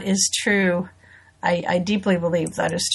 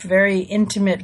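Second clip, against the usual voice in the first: ordinary delivery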